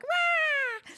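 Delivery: high, loud squeal; cute imitation cry. Shown as a demonstration. Streamer demonstrating how she imitates cute things, high squealed cry. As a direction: let out a high cutesy cry. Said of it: "I imitate it".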